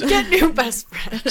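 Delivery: slow, laughing repeat. Real, not acted. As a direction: laugh through the words. Laughing repeat of friend's advice.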